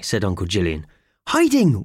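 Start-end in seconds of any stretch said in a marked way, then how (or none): none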